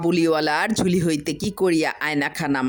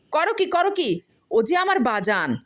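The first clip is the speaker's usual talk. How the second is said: sharply higher cry of alarm; frantic protest over the mirror; 12 semitones higher than usual. Acted. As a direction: stop her before she breaks it.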